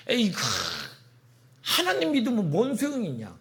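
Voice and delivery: high, scoffing jeer; mocking. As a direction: sneer like unbelieving neighbours. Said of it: "이러고 조롱하더라는 거예요". (비아냥대며 조롱하는 말투)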